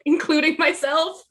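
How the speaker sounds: laughing. Real, not acted. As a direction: laugh as you speak. Laughing at own Supernatural remark.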